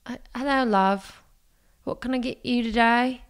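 British shop assistant's greeting; British accent. Acted. Salesperson greeting a computer customer, British accent. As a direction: put on a cheery-customer-service British accent. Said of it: "try to do an accent".

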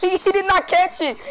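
bursting into a loud laugh, then the words; loud laughing disbelief at the dropped catch. Real, not acted. Teammate drops the lob pass.

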